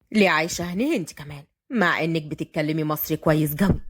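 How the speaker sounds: low-pitched male character voice; Fahd, proud self-made man. Acted. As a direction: drop the pitch, answer with calm pride. (بصوت فهد الواطي الواثق)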